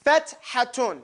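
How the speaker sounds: Fethatun broken into clear parts; separated syllables. Shown as a demonstration. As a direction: model Fethatun in separate pieces. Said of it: "You see how I separated them?".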